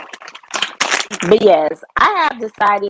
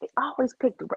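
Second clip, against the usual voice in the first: normal talking voice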